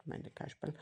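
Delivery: plain reading voice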